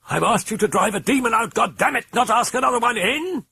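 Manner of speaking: shrieking in sudden hysteria; hysterical cry. Acted. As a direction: break into hysterics at the priest. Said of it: "she cried in sudden hysteria".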